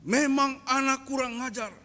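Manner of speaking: high, scolding father voice; angry father. Retold or imitated. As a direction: berate the son for leaving. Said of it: "bapak saya datang marah-marah". (bapak yang marah dengan suara tinggi)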